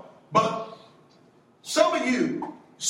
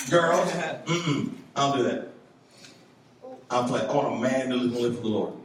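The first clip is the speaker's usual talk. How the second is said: put-on deeper, earnest voice for a boy saying no; a lightly voiced godly young man, lower; lowered mock pious guy; 8 semitones lower than usual. Youth pastor voicing a boy who turns girls down, low and earnest. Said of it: "And you go".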